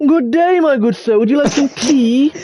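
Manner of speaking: high, lilting posh voice, drawn out; hammed-up posh English toff offering tea; posh English accent. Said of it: "She's just a posh twat".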